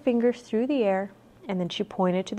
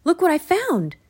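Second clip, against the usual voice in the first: high, raised call; 9 semitones higher, 5 dB louder than usual. A narrator's reading. Jack calls Annie to look at what he found, calling out. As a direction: call out to show a find. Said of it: "Jack called".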